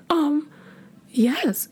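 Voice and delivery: very high shy squeak; shy smitten yes. Retold or imitated. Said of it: "I was just like".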